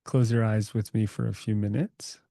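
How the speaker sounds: calm, unhurried meditation guide; guided meditation. Acted. Guiding the group to relax their bodies, calm meditation voice. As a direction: ease the group into stillness, gently.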